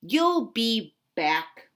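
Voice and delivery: slow, loud, deliberate retort; stormed. Acted. Cat storms at the departing duck, slow and loud. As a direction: drag it out with scorn. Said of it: "stormed the cat".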